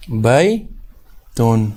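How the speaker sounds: بیتن split slowly into "bai" and "tun", sounding the final n; syllable-by-syllable demonstration. Shown as a demonstration. Teacher showing the noon sound at the end, بیتن slowly split "bai... tun". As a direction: draw out بیتن in two separated syllables, ending on an audible n. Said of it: "یہاں پر نون کی آواز نکل رہی ہے آخر پر".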